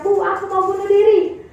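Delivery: usual speaking voice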